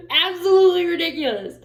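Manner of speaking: loud, high exclamation after a laugh; incredulous outburst. Real, not acted. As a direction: cry out in amused disbelief. Laughing outburst at wife's behaviour.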